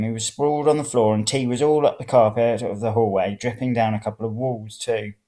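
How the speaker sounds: ordinary delivery, nothing marked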